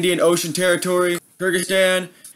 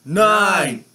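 ordinary talk, nothing marked

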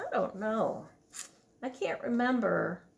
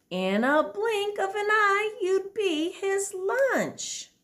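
higher, louder motherly warning; dinosaur mom; 9 semitones higher, 4 dB louder than usual. Acted. Dinosaur mom warning her child, high and urgent. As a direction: warn your little one with wide eyes. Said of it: "The dinosaur mom said".

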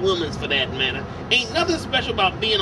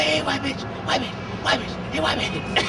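taunting; 4 semitones higher, 4 dB quieter than usual. Pretend.

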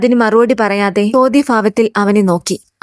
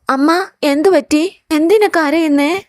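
higher-pitched, lightly acted child voice; worried little son; 5 semitones higher than usual. Son asking his crying mother what is wrong, worried child voice. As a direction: ask like a concerned child, pitched up. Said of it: "Ashwin നീലിമയുടെ കവിൾ തൊട്ടുകൊണ്ട് പറഞ്ഞു".

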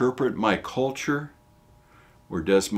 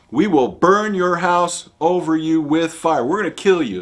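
loud, higher threatening voice; angry threat; 8 semitones higher, 9 dB louder than usual. Retold or imitated. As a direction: threaten to burn his house. Ephraimites threaten Jephthah.

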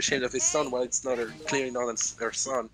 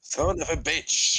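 put-on slow, louder French accent; 4 semitones higher, 6 dB louder, slower than usual. Friend imagining Kevin with a French accent. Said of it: "With a French accent".

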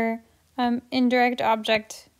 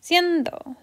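"Siendo" with "Sien" pitched higher; stress on SIEN; 5 semitones higher than usual. Shown as a demonstration. Teacher repeating where the accent naturally goes, high stress on "Sien" in "Siendo". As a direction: lift the first syllable of "Siendo". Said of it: "Our accent naturally goes here on cien".

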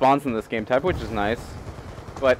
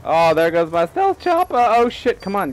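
high, louder dismay; frustrated outburst; 8 semitones higher, 3 dB louder than usual. Real, not acted. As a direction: groan at losing it. Losing his killstreak.

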